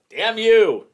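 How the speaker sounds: loud playful curse; mock outrage. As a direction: fake fury, laughing. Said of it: "mock curse at cohost".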